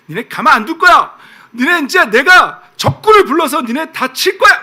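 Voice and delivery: acted fiery threat, high and loud; God. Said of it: "막 불같이 화내셨다가". (불같이 화내며 으름장 놓는 하나님)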